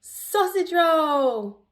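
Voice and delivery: high, loud, slow drawn-out whoop; triumphant cry. A narrator's reading. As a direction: cheer as you roll down the hill. Snickers rolling triumphantly.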